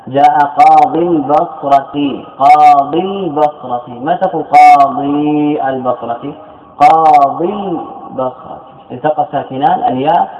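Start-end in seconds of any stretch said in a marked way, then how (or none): 0.1-8.6 قاضي البصرة repeated slowly and evenly; slow deliberate repetition. Shown as a demonstration. Teacher slowly repeating an example for students. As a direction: drag out قاضي البصرة so the class hears it. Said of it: "repeats the example slowly".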